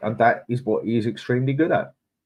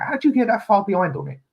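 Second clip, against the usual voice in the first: much higher, fast mock-baffled squeak; mock incredulous self; 13 semitones higher, 5 dB louder, faster than usual. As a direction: mimic his old clueless self, squeaky and quick. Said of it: "I used to say".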